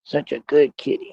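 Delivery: sweet talk to a cat. Real, not acted. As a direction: fuss over the tabby. Baby talk to his cat.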